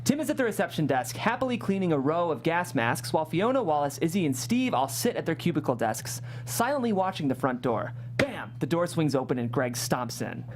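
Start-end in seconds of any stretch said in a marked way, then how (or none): none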